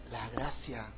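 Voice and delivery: hushed whisper of la gracia; soft reverent whisper; whispered answer. Acted. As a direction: breathe the answer out as a secret. Preacher whispers key answer.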